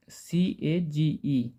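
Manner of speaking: slow, letter by letter spelling. Shown as a demonstration. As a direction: spell each letter out slowly for the class. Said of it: "ये क्या है?".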